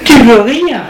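mocking woman scoffing at him. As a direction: laugh it off in his face. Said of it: "Elle lui rit au nez".

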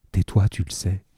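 just lightly hush the words; barely a breath. A narrator's reading. Cosette answering Marius, a mild hushed murmur. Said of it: "d'une voix si basse que ce n'était plus qu'un souffle".

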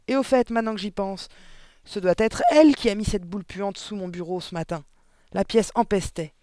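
an overbearing headmistress laying blame; domineering headmistress, indignant. A narrator's reading. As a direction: accuse with blustering certainty.

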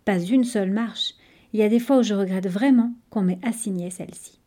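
ordinary delivery, nothing marked

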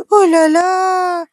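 high, loud, drawn-out gasp of amazement; astonished exclamation. A narrator's reading. Child crying out at what she sees, astonished. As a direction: cry out slowly, way up in pitch. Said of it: "s'écriait Pauline".

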